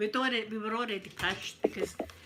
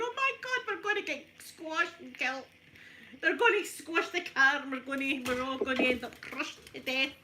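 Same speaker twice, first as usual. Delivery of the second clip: high, panicky wail; mock panic; 5 semitones higher, 3 dB louder than usual. Reliving the cows round the car, a hammed-up frightened squeal. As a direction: squeal it like you're about to be crushed.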